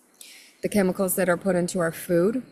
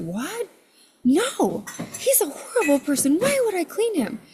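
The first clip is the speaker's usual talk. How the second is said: higher-pitched retold refusal; indignant protest; 11 semitones higher than usual. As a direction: protest in mock disbelief, rising high on the question.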